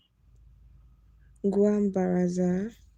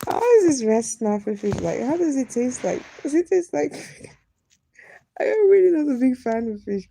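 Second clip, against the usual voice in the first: loud, high laughing questions; laughing excited; 5 semitones higher, 8 dB louder than usual. Real, not acted. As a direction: giggle through the questions about the fish. Laughs asking about fish.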